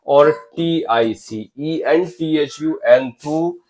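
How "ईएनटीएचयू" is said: usual speaking voice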